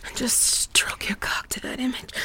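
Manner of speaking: soft, hushed instruction; intimate whisper. Pretend. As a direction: drop to a private whisper.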